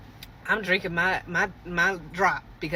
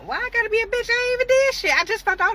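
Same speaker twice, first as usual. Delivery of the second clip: high, louder indignant protest; indignant outburst; 5 dB louder, faster than usual. Real, not acted. Teller protesting she did nothing, indignant and high. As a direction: fire back, offended and loud.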